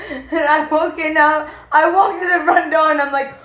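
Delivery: can't stop laughing as you tell it. Real, not acted. Laughing while retelling fright.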